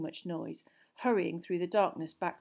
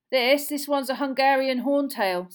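higher, much louder character voice; dragon keeper; 7 semitones higher, 10 dB louder than usual. Acted. Dragon keeper naming the breed for Hagrid, raised and hearty. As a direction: present the beast with keen pride.

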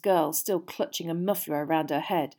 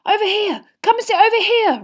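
high, loud, urgent calling; excited boy calling out in a squeezed high voice; urgent hiss; 11 dB louder than usual. A narrator's reading. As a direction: beckon someone over eagerly. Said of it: "Ron hissed".

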